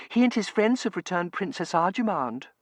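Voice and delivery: high-pitched, eager defence; pleading daughter. A narrator's reading. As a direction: explain to Papa in a high pitch. Daughter interceding with father.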